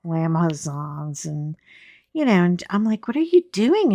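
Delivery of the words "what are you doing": pitched way up in disbelief; high, bewildered what are you doing; baffled question. Retold or imitated. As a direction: ask it like you can't believe your eyes. Quoting herself, baffled at friend.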